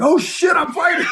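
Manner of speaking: sudden high yell of alarm; startled shout. Retold or imitated. Fighter coming to mid-fight, shouting in sudden alarm.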